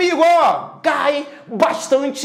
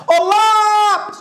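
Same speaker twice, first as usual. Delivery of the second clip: high, stretched call on lápis; long drawn-out call; 8 semitones higher, 4 dB louder, slower than usual. Shown as a demonstration. Teacher hollering an example word to the class, stretched call. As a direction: holler lápis, holding the first vowel.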